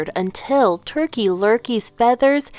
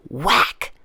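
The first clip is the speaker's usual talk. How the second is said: ordinary delivery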